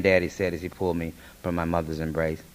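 usual speaking voice

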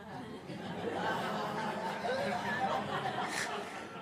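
long, high helpless laugh. Real, not acted. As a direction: crack up mid-story. Cracking up while reading.

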